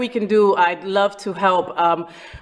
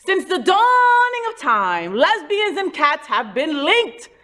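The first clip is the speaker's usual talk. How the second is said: mock epic narration; 6 semitones higher, slower than usual. Mock epic storytelling opener.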